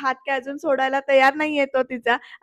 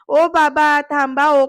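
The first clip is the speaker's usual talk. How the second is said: slow, louder call to stop him; drawn-out call, a bit louder; 5 dB louder, much slower than usual. Retold or imitated. Daughter calls out to her father to stop, slow and louder.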